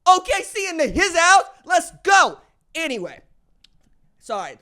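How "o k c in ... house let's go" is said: loud, high rallying cry; hyped shout. Real, not acted. Fan cheering on Oklahoma City, hyped shout.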